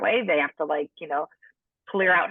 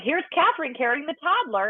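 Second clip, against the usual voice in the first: high, sing-song teasing announcement; teasing nurses; 8 semitones higher than usual. Retold or imitated. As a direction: call it out like a playful nurse. Nurses joking about her big belly.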